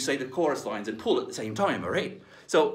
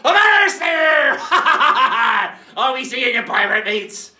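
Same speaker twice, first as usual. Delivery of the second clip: pirate hail; 12 semitones higher, 12 dB louder, slower than usual. Acted. Pirate calling out to crew.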